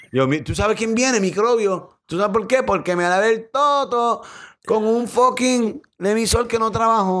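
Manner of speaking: squeaky mock girl voice, tossing it off; high mock woman voice. Mocking a woman who bathed in expired soap, high pitched.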